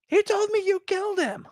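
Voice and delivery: imitating Luke accusing Vader; mock Luke Skywalker. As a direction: squeak it like the wronged son. Acting out Luke's line.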